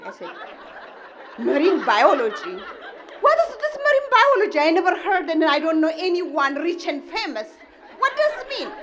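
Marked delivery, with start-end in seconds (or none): 1.4-8.7 much higher, fast incredulous questions; can't believe what you hear; mother shocked by son's new career; baffled disbelief. Retold or imitated. Mother baffled by marine biology.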